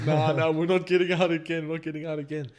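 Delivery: loud, high scared refusal; panicked refusal. Retold or imitated. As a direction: insist loudly you won't go out. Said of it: "they're like".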